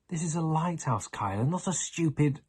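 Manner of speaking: put-on a touch of boyish anger; indignant outburst. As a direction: Charlie snapping at Kyla, cut off. Charlie angry outburst.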